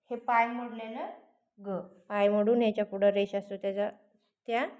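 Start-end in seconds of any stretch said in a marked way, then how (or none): none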